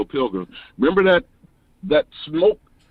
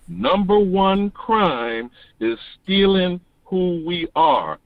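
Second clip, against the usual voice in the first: normal talking voice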